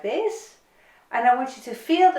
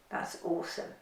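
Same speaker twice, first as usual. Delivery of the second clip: whispered praise; 5 semitones lower, 14 dB quieter than usual. Real, not acted.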